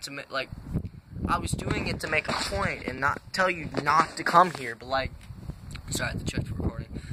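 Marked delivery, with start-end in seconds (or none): 5.9-7.0 hushed aside. Real, not acted. Muttered aside while checking recording.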